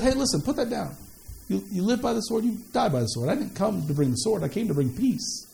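calm him down, hold him back gently; soft Jesus voice. Acted.

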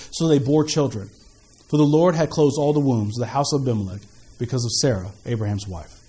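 low, measured Bible reading; formal reading with falling phrase ends; scripture reading. Acted. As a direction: read the passage aloud, solemn and steady. Reads scripture aloud.

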